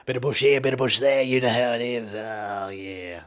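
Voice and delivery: hammed-up Bogan, like a guy from outback Australia; Bogan accent. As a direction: put on a broad Bogan voice. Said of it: "it's basically a Bogan accent".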